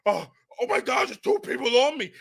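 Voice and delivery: lightly mock a player overwhelmed by two blockers; mimicking the overwhelmed tackle, mildly panicked; mocking a flustered player. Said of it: "looking like this".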